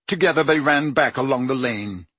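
usual speaking voice

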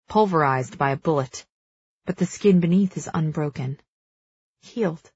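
plain reading voice

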